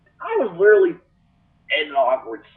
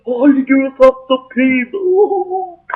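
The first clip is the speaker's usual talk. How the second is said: slow, much higher whiny customer voice; mocking rude customer; 10 semitones higher, 6 dB louder, slower than usual. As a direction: drag it out like a snotty complainer.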